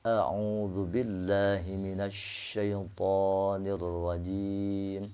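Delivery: slow chanted Arabic with rolled ra; slow tajwid recitation. Shown as a demonstration. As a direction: recite it slowly, Quran style. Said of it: "atau yang fasihat".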